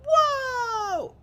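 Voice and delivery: long, very high falsetto whoa; mock shock. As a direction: imitate a stunned crowd with a loud squeal. Voices the whole crowd's shock.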